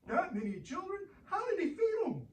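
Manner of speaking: high, fast amazed squeak; child puppet stunned by the number. Acted. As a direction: gasp it out in disbelief.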